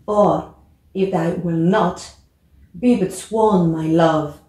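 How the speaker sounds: slow dramatic reading of Juliet's lines; teacher models Juliet's balcony speech, slow and expressive. Shown as a demonstration. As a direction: read the balcony scene with feeling. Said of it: "je vais essayer de le prononcer".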